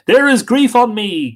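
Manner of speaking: high, loud cry of grief; loud lament for a fallen man; loud grieving cry. Acted. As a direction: call it out in grief. Finn's lament opens.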